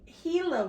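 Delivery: Gila said "HEE-la", with an H sound; careful pronunciation. Real, not acted. Reader makes sure to say it right, Gila as "HEE-la". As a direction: open Gila on an H, not a G. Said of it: "Gotta make sure I say that one right".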